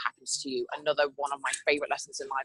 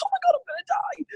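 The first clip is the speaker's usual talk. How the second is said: shrieked far higher, panicked; mock panic; 5 dB louder than usual. To her listeners, imagining falling from the coaster, high mock shriek. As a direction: squeal like you're falling out. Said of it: "you're like".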